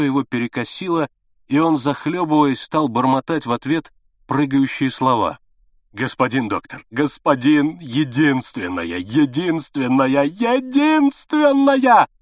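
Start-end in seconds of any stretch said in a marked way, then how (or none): 5.9-12.1 ringing youthful cry. A narrator's reading. Desperate father begs the doctor, ringing cry. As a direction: cry out for his only daughter, rising to a scream. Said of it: "выкрикнул он вдруг по-юношески звонко".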